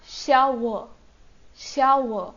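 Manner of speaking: श्या व with a clear sh sound on श; Korean pronunciation demo. Shown as a demonstration. Teacher models the real Korean sound श्या व. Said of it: "श sound आ रही है".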